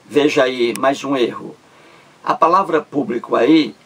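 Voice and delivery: usual speaking voice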